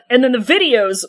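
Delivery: ordinary talk, nothing marked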